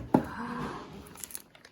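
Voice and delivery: long breathy gasp of wonder; amazed gasp. Real, not acted. Teacher showing children what is inside, amazed gasp.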